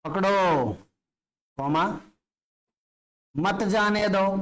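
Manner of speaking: "पकड़ो" alone, pause, then drawn-out "मत जाने दो"; comma after पकड़ो. Shown as a demonstration. Teacher dictating the other meaning, pausing after "पकड़ो". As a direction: break clearly between "पकड़ो" and "मत". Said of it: "थोड़ा सा रुक के बोलना है".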